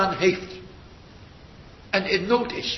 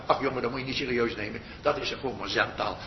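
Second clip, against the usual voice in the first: mocking dismissal; 8 semitones lower, 5 dB quieter, faster than usual. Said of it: "waarbij iedereen me zal uitlachen".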